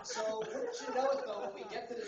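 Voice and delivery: cracking up. Real, not acted. Comic cracking up at the gym excuse, laughing hard. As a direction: crack up and push the words out.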